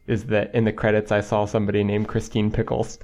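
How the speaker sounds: ordinary talk, nothing marked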